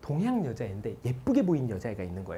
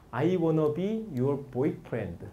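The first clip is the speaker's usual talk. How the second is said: slow demo; 2 semitones higher, much slower than usual. Shown as a demonstration.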